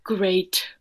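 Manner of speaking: dry unvoiced whisper; sarcastic whisper. Doctor Cat, newly allergic, whispering dryly. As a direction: mutter it with dry irony.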